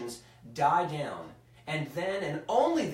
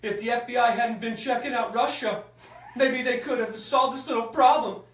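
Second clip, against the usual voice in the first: bad presidential impression, high and loud; badly faked Trump voice, like Christopher Walken mixed in; terrible Trump; 8 semitones higher, 8 dB louder than usual. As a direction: raise the pitch and volume in a hammed-up impersonation. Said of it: "most terrible Trump impression I've ever heard".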